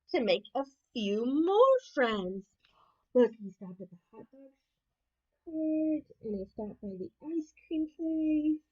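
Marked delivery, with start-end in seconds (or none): none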